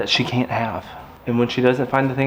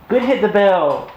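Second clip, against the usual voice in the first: a bright, higher, drawn-out praise for the puppy; excited praise; 9 semitones higher, 4 dB louder, slower than usual. Real, not acted. Owner praising puppy for ringing the bell, excited. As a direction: cheer the puppy on, slowly.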